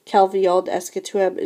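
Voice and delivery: ordinary delivery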